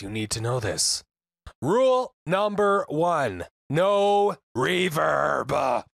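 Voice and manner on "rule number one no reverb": emphatic shout. Real, not acted. Rule one, emphatic shout.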